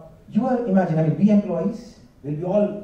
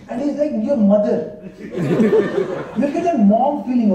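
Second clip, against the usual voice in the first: ordinary talk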